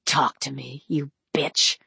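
low, soft menacing growl. A narrator's reading. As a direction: growl it like a dog. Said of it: "voice so low ... growl of a dog".

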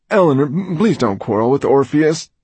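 anxious appeal; pleading. A narrator's reading. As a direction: beg nervously, tripping on the first words. Pleading quote voiced by narrator.

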